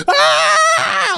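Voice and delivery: high-pitched yelp of a scream; mock waking scream. Dreamer imitates himself waking from the dream, mock scream. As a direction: scream as if jolted out of sleep. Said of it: "I was like".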